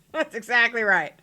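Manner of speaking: ordinary talk, nothing marked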